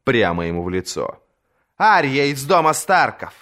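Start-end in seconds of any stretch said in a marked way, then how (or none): 1.8-3.3 louder, proud declaration; said loudly. A narrator's reading. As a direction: say it boldly to his face. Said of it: "повторила громко".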